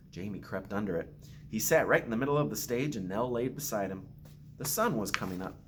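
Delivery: put-on gentle storytelling read to children; story narration. Principal reading a picture book to students, gentle storytelling.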